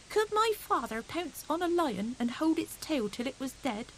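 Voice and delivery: put-on high, eager small boy's boast; a small hedgehog bragging to his cousins; little hedgehog voice. Little hedgehog boasting to his cousins, high and eager. Fuzzy Peg asks anxiously.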